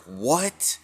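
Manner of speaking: high rising "What?"; shocked reaction. Retold or imitated. All the gods hear his decision, shocked. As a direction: exclaim it in sheer disbelief.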